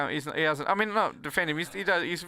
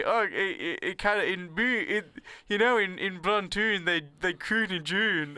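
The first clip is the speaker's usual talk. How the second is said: slow, higher mock Scottish; Scottish pub accent; 4 semitones higher, slower than usual. Retelling talk in a Scottish pub, mock Scottish accent. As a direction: draw out the Scottish "oo" in every rhyme. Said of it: "talking about how they say".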